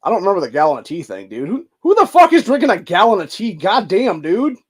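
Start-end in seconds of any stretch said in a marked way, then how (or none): 1.5-4.6 higher and louder, disbelieving; incredulous outburst. Real, not acted. Reacting to a gallon of tea, incredulous outburst. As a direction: blurt it out in disbelief.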